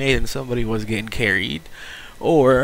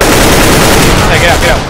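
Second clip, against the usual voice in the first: high, loud urgent yelling; loud shout; 7 semitones higher, 15 dB louder, slower than usual. Real, not acted. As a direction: yell it in a panic. Shouting at teammates in panic.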